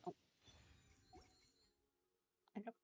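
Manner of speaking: normal talking voice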